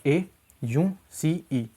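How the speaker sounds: slow spelling out. Shown as a demonstration. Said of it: "तो यहाँ पे देखो एस".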